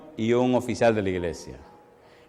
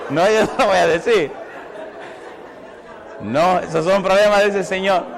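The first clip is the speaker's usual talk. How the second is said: louder, still laughing; playful laughing refusal to tell the gossip; 5 semitones higher, 4 dB louder than usual. Real, not acted. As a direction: refuse with a grin in the voice.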